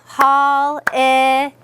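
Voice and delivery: "hall-i-day" very slow, split in three; syllable by syllable. Shown as a demonstration. Teacher leading up to the last syllable, slow and separated. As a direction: pause and stretch each part of "hall-i-day". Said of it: "And then our last syllable".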